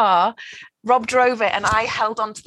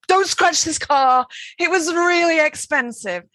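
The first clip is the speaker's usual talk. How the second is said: higher, slow, pleading retold worry; anxious plea; 9 semitones higher, slower than usual. Wife in the passenger seat to husband driving, anxious high plea. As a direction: beg over and over not to scratch the new car. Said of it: "I was going".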